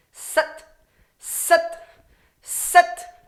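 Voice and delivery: Sat whispered as a sharp burst. Shown as a demonstration. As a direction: snap out Sat with breath only. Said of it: "the way we're going to emphasize the word".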